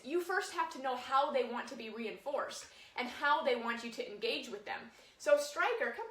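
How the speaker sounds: ordinary delivery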